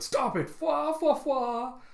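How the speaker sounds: high, hooting protest into fwas; acted high-pitched indignant laughing; Killer mad but laughing. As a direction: protest through a cartoon laugh. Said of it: "Killer getting mad and went".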